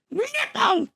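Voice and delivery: NIPPLE blurted out high; a sudden high shout of NIPPLE. Shown as a demonstration.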